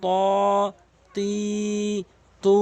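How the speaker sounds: ত, তি, তু each vowel held long; drawn out syllables. Shown as a demonstration. Teacher drilling ত, তি, তু stretched. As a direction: stretch ত, তি, তু one count each. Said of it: "এভাবে টেনে টেনে পড়বেন".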